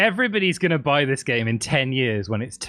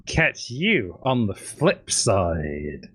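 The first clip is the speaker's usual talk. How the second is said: low slow sign-off; 6 semitones lower, 3 dB quieter, much slower than usual. Pretend.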